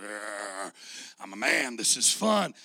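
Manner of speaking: loud, gleeful macho cheer. Retold or imitated. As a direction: belt it out like a proud tough guy. Guys enjoying demo day.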